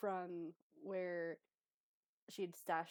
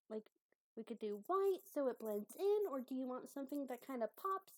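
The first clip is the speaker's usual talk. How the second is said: higher-pitched, eager seamstress voice; eager helpful character; 7 semitones higher than usual. Acted. Abby asks Croak about thread color, eager and helpful. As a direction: offer choices brightly in a raised voice.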